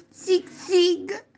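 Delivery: high, punchy zig-zig noise; comic-book grumble. Acted. Boy grumbling in comic-book language, high zig-zig. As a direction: squeak out a cartoon grumble. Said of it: "mugugnò Alvise".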